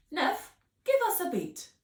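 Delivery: high, loud, brisk order; brisk command. Acted. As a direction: demand a beat now. Miss Potts demanding.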